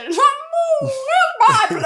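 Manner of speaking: panicked. Retold or imitated. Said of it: "panic in snake scene".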